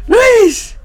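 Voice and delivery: very high mock shriek of a name; Katana's outburst. Katana slams the door on Luis, mock shriek. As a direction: squeal the name as an imitation, without real volume. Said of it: "slamming the door, and she's like".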